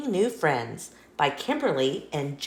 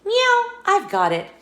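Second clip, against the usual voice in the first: high, loud burst of discovery; like the answer just clicked; excited cat detective; eureka; 14 semitones higher, 6 dB louder than usual. Acted. Meow's eureka moment.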